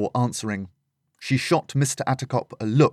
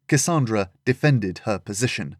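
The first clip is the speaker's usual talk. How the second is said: usual speaking voice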